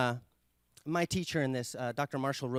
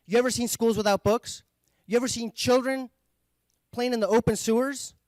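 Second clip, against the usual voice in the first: high, loud, bitter questions; imitating an outraged man, higher and louder; retold bitter challenge; 7 semitones higher, 5 dB louder than usual. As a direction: throw the questions at him in anger. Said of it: "And the man said".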